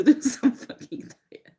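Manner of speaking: real giggle breaking mid-sentence; half-laughing self-scolding. Real, not acted. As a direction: let a laugh break into the words. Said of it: "Sorry for laughing at".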